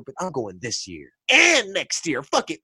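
normal talking voice

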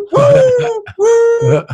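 very high repeated whoops; excited whooping. Real, not acted. Fan carried away by his boast, high whooping.

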